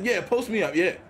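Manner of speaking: ordinary talk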